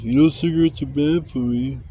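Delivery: mock scolding with a mild lift; sarcastic chastising. Sarcastic viewer lecturing a smoker, mock chastising. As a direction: imitate a preachy sarcastic commenter. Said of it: "a sarcastic fucking adult".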